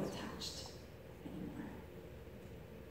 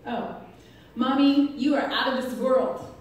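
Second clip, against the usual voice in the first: put-on higher, loud child-like declaration; imitated gushing daughter; 4 semitones higher, 10 dB louder than usual. Daughter complimenting her mom, imitated with loud gusto. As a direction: proclaim it to Mommy, lightly voiced child.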